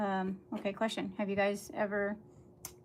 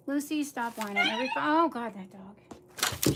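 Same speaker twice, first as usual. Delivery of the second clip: loud, raised exasperated groan at the dog; real exasperation, pitched up; exasperated scolding; 3 semitones higher, 5 dB louder than usual. Real, not acted.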